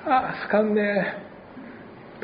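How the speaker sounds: a local turning up their nose at an outsider; disdainful local. Retold or imitated. Fukuoka churchgoer mocking the pastor's Oita words, a soft high sneer.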